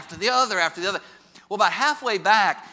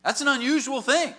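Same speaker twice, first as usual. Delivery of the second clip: ordinary talk, nothing marked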